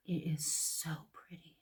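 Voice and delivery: hushed, admiring whisper. Real, not acted. Vlogger whispering to viewers while swatching eyeshadow. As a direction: whisper it like a secret.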